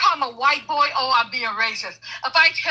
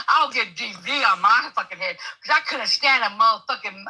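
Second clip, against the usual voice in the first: ordinary talk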